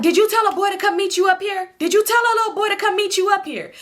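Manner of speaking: high-pitched imitation of a sister demanding answers; accusing interrogation. Storyteller voicing her sister questioning her about a boy, high-pitched and accusing. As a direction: act out grilling someone, voice pitched well up.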